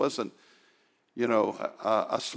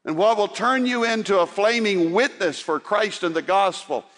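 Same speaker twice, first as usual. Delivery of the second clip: louder, higher preaching, urging the church; pleading with the church to care, raised voice; fervent preaching; 8 semitones higher, 4 dB louder than usual. Acted. Preaching at full force.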